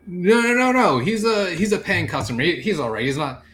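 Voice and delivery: high, loud panicked objection, settling gradually; flustered pleading. Acted. Royce stops the knight dragging off a customer, flustered. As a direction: jump in anxiously to defuse it.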